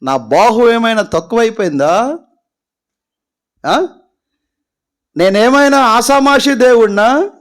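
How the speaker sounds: high, loud, booming God rebuke; God rebuking. Acted. God scolds weeping Moses, high and loud. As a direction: thunder it out as an offended God. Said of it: "అప్పుడు దేవుడు అంటున్నాడు".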